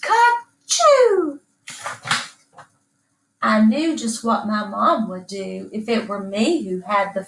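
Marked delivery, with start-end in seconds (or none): 0.0-1.4 very high "Ka-choo" sneeze; the chickens sneeze high and loud. A narrator's reading. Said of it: "the chickens clucked".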